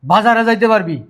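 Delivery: loud, high, emphatic scolding; angry ultimatum. Acted. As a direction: lay down each condition forcefully. Elder brother lays down angry ultimatum.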